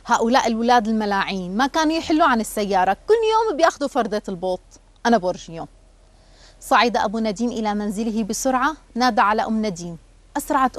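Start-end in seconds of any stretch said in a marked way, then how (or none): none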